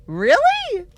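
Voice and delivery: high shocked "Really?"; genuinely taken aback; surprised. Real, not acted. Surprised at Canadian spelling.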